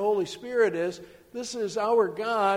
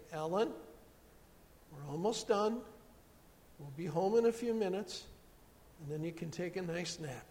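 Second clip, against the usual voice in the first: quiet, soothing mother; soft, gentle voice; 2 semitones lower, 8 dB quieter than usual. Retold or imitated. Mom at the checkout promising a nap, soft and gentle. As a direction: reassure softly, sinking softer toward the end. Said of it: "in a soft, gentle voice".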